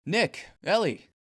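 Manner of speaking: loud sharp call up the stairs; calling out abruptly. Acted. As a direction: call the names out suddenly. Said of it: "he calls abruptly".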